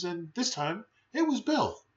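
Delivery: usual speaking voice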